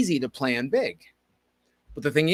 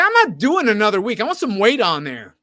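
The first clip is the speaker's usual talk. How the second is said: louder, higher arguing; retold protest; 7 semitones higher, 8 dB louder than usual. Refusing another week of the bar, arguing louder. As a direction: push back hard on the coach. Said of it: "I was arguing with him".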